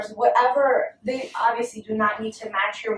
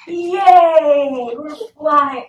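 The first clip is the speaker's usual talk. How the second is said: loud, high cheer of delight; excited cheer; 4 semitones higher, 8 dB louder, much slower than usual. Real, not acted. Mom cheers her toddler flying like a bird, loud and high.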